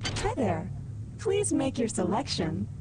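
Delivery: high synthetic kiosk greeting; vending machine. Acted.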